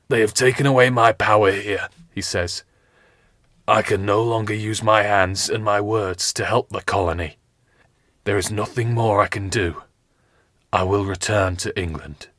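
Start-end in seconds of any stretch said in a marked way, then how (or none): 0.1-1.9 a lightly voiced weary captain, louder; wounded captain. Captain Smith telling his page and Richard, lightly voiced weary firmness. 3.7-12.3 gather strength and speak firmly; wounded captain. Acted. Captain Smith telling his page and Richard, lightly voiced weary firmness.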